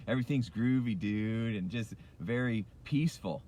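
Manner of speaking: lightly put-on mellow dude; laid-back, groovy. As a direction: play it calm and easygoing, low and peaceful. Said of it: "pretty calm and laid back".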